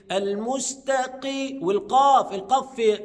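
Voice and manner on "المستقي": "المستقي" drawn out, thin t before the qaf; correct light ta. Shown as a demonstration. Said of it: "يبقى الصح أقول إيه؟".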